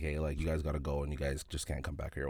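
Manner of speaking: low, very soft retold mumble; half-hearted dismissal. Security guard telling shoppers to leave, half-hearted. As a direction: send them off quietly, without any force.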